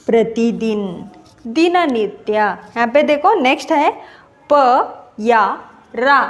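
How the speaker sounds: usual speaking voice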